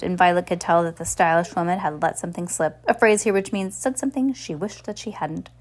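ordinary delivery, nothing marked

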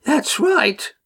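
put-on high, quavering old woman's voice. A narrator's reading. Old woman interrupting Mufrid, high quaver. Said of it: "Pegeen quavered".

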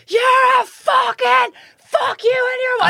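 high, slow, ranting stadium yell; mock furious fan shout. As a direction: scream abuse like a raging supporter. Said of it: "he's like".

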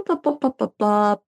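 normal talking voice

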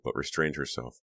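plain reading voice

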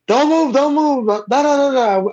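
high-pitched mock yell of agents storming in; imitating police yelling. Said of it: "Wally heard".

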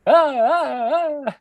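high hammed-up falling cries; imitating a fake movie fall; mock cries. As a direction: yelp like a cheesy stunt fall. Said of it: "is it like".

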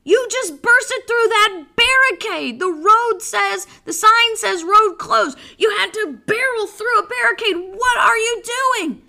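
high, loud, alarmed scolding; acted shriek of outraged disbelief; yelling wife. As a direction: yell at him like a panicked wife. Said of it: "his wife yells".

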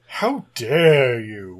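very slow, drawn-out mock offence; mock indignation. Co-host defending Audacity, mock indignant. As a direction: stretch it out as if gravely insulted.

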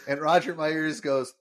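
normal talking voice

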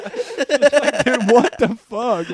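loud, high outburst through laughter; laughing disbelief. Retold or imitated. Reacting to the grim coworker bit, laughing incredulous shout. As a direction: stretch it out, cracking up.

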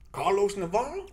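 doubtful roll call, rising at the end; puzzled coach. Retold or imitated. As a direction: read the name as if it can't be right. Coach doubts name after seeing him.

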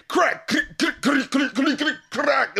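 high-pitched stuttering sample chop; imitated looping vocal hook. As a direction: repeat it like a chopped-up record sample. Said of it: "that sample initially of the [name] saying".

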